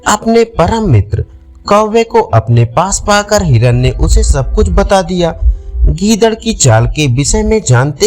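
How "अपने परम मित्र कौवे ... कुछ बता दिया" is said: ordinary delivery, nothing marked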